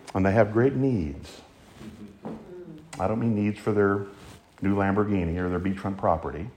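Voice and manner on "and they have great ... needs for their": usual speaking voice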